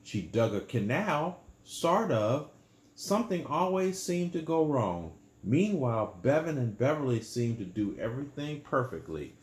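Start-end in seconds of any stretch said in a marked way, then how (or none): none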